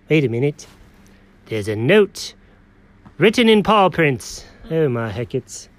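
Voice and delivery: ordinary talk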